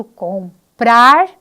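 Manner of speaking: teacher singling out the rhyme word, loud; loud example word. Shown as a demonstration. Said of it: "como por exemplo".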